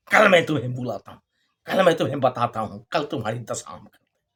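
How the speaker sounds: fast, low mock threat; master's angry threat. Master threatens the braying donkey, fast lightly angry threat. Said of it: "बड़ा क्रोध आया मालिक को".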